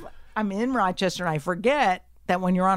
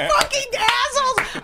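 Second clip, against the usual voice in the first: very high, loud scream; angry outburst; 7 dB louder than usual. Retold or imitated. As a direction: explode at the couple.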